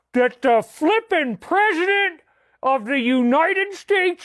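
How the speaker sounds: hammed-up angry father chewing out son David; Angry Daddy rant. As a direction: rant like an outraged old-fashioned dad lecturing his boy. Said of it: "as Angry Daddy Incarnate".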